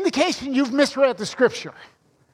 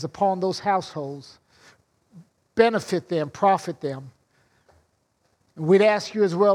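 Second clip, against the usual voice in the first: opening prayer; 5 semitones lower than usual. Acted. Pastor leads an opening prayer.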